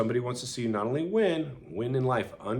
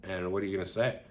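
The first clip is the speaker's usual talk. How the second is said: ordinary talk, nothing marked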